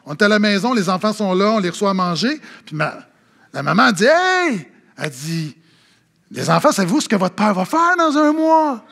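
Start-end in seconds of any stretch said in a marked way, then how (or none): 4.1-4.7 high, drawn-out "hey"; calling out. Retold or imitated. Wife calling the children at dinner, calling out. 6.3-8.8 imitated wife, pitched higher; higher, put-on voice of a mother's big question; teasing announcement. Wife telling the children dad's plan, teasing announcement.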